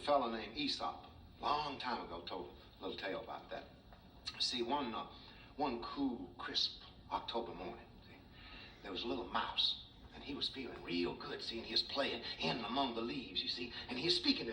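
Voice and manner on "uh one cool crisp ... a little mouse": ordinary delivery, nothing marked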